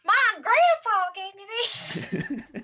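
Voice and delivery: high, drawn-out mock Southern whine; exaggerated Southern child's complaint; Southern accent. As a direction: wail it in a hammed-up Southern accent. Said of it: "my character did not have Southern accent".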